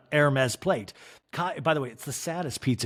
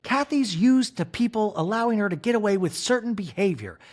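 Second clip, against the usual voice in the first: higher mock indignant voice; a touch of wounded pride; Kyle impression; 8 semitones higher, 3 dB louder than usual. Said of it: "Kyle in a talking head goes".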